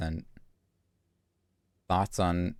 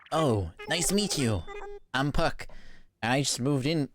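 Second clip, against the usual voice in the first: lightly voiced cheery new neighbour, a bit higher; villager voice; 6 semitones higher than usual. Streamer reading the new villager's greeting, lightly voiced up. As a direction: read the villager's lines a touch higher and brighter.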